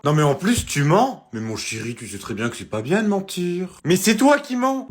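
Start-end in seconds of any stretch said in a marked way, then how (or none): 3.8-4.9 high loud accusing shout; angry accusation. Acted. Son yells that mum is the liar. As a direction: yell the accusation back.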